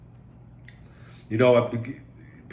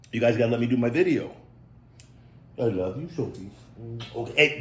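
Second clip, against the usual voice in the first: normal talking voice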